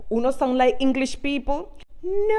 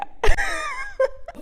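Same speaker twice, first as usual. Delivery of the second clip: real laugh, squealing high. Real, not acted. Laughs at own joke.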